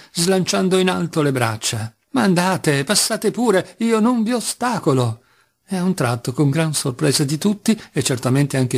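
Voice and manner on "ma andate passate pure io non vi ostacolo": rising wildly until he nearly breaks; overwrought outburst. A narrator's reading. Mitya flinging up his arms at the guests, rising overwrought outburst. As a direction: voice climbing higher and higher, arms flung up.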